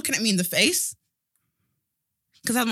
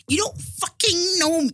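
high, loud, slow sassy quote; hammed-up attitude, neck roll included; sassy diva quote; 8 semitones higher, 5 dB louder, slower than usual. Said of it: "Just even did her neck".

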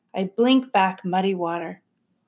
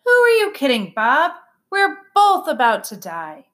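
indignant little sister; 5 semitones higher, 5 dB louder than usual. A narrator's reading. Sister despairs during flood.

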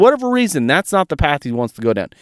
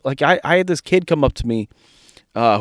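usual speaking voice

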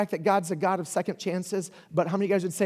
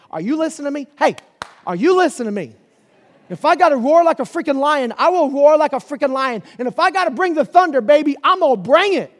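booming shout, far higher and louder; roaring shout; 7 semitones higher, 10 dB louder than usual. Acted. Preacher voicing God warning Israel, roaring shout. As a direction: roar it out like a lion bringing thunder.

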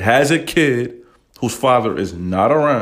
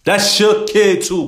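higher, slow, insistent; fired up; 10 semitones higher, 4 dB louder, slower than usual. Real, not acted. Host lecturing stepfathers, worked up and emphatic. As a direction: press it hard on the stepdad. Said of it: "I get a little emotional when I say shit".

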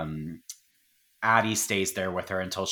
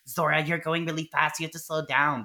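lightly voiced parents in a higher pitch; worried parents, imitated; 5 semitones higher than usual. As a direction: mimic parents warning their speeding daughter. Parents voiced warning daughter.

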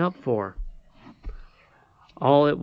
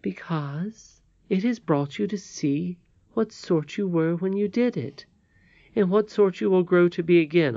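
higher, lightly voiced old lady; kindly old lady; 6 semitones higher than usual. Old lady forgives Curdie for shooting her bird, kindly and patient. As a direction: explain patiently in a raised, tender voice.

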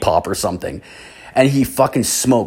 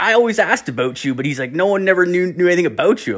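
higher put-on dude voice; stoner guy voice; 7 semitones higher, faster than usual. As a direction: play the dopey buddy, pitched up. Same guy voiced in story.

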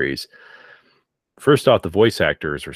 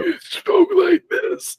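slow, strained gasping creepy voice; much slower than usual. Retold or imitated. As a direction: gasp the words out like a ghoul. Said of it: "that gasping voice".

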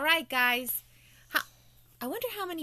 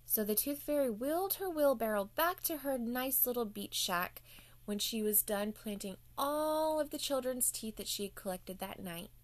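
usual speaking voice

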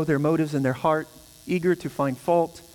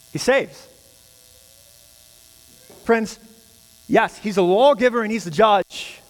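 a touch higher and louder preaching; fervent preaching; 5 semitones higher, 5 dB louder than usual. Acted. Pastor to congregation about the Savior, fervent preaching. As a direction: proclaim it with conviction.

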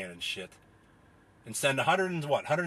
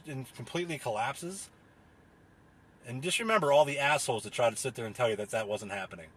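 ordinary talk, nothing marked

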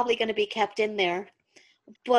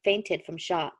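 low, steady storytelling voice; story narration; 4 semitones lower than usual. A narrator's reading. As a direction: read it like an audiobook. Reads the story aloud.

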